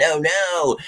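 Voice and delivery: high-voiced pretend worry; mock worry. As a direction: feign a quick little panic about it. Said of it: "we're not looking at our schedule saying".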